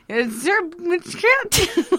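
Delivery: high, garbled, stumbling nonsense; nonsense noises. Retold or imitated. Actress stumbling onstage, high garbled babble. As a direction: babble like the words won't come. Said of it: "literally making nonsense noises".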